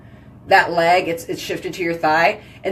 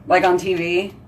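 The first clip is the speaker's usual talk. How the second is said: normal talking voice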